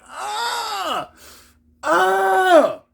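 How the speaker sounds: high drawn-out labor wail; imitated holler of pain. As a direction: wail like someone balled up in pain.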